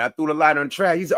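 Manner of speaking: ordinary talk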